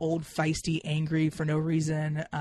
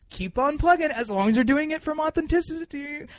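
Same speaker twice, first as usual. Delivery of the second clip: mock cheery; 10 semitones higher, 6 dB louder than usual. Mock cheerful support for others.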